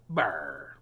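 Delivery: low pirate arr; pirate growl. Pretend.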